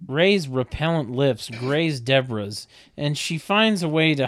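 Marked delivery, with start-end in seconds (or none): none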